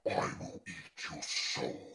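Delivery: low, slow, breathless demon whisper. Retold or imitated. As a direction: growl it out like a possessed girl, slowly. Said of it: "she says in this voice".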